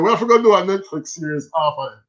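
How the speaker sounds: usual speaking voice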